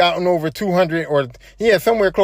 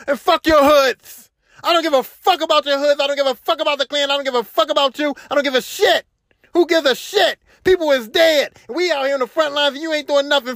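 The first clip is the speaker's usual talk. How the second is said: higher-pitched imitation of an angry rant; retold losing it; 5 semitones higher than usual. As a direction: act out his outburst, curse after curse. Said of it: "and he lost it".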